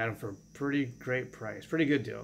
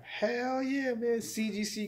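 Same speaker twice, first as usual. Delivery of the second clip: a real whoop of delight over a prized find; excited; 11 semitones higher, much slower than usual. Real, not acted. Vlogger showing off his Moon Knight book, thrilled. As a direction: burst out high and thrilled.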